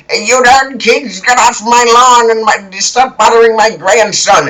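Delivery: put-on high, cranky old-man yell; hammed-up old man's scolding shout; shouting grandpa. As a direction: holler like a grandpa chasing kids off. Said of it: "And then he's like".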